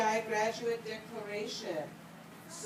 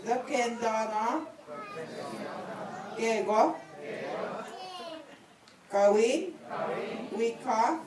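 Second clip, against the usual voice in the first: phrase by phrase, long pauses for repetition; said slowly. Shown as a demonstration. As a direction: lead a group recitation, lifting each phrase's end. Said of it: "I'm gonna say it slowly".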